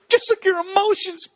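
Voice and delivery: high fake-crying punchline; hammed-up weepy squeal; mock emotional outburst. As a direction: imitate bursting into tears. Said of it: "a guy getting emotional like that".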